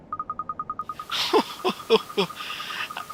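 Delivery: put-on high cackling old woman laugh; old lady giggle. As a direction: let out a high granny laugh. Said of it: "my old lady voice".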